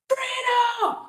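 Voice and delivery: high, loud bellow held long; Tony Robbins yelling. Acted. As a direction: roar the battle cry to rouse the room. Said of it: "he yelled".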